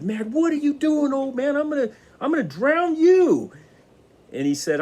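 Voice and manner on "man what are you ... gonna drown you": high, loud, panicked outrage; a man nearly drowned, yelling at the old man high and loud; frantic furious cry. Retold or imitated. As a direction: yell at him, breathless and enraged. Man furious after near drowning.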